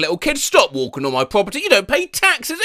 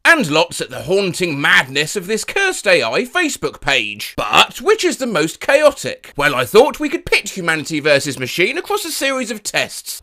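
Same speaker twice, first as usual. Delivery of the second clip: normal talking voice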